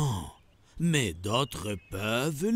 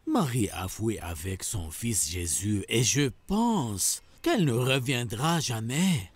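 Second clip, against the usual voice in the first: usual speaking voice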